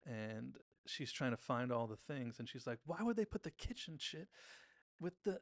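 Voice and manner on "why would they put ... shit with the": mock pissed-off. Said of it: "she's getting pissed".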